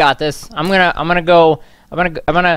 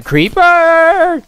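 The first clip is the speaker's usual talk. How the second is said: high, loud, drawn-out cry of alarm; startled yell; 5 dB louder than usual. Real, not acted. Player spotting a creeper mid-explanation, startled yell. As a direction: break into a long rising yell.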